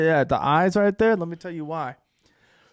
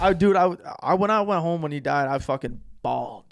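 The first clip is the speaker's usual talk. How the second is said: normal talking voice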